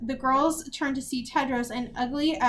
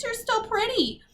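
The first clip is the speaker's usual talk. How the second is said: very high-pitched sulk; whiny envy; 10 semitones higher than usual. A narrator's reading. Beatrix envies Agatha her looks, whiny. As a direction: whine it with resentment.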